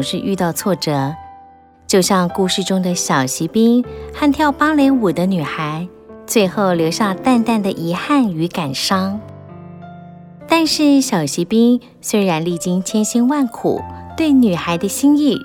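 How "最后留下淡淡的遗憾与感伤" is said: ordinary delivery, nothing marked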